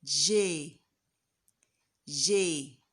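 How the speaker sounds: "J." as the French "gé". Shown as a demonstration. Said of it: "You sound it as".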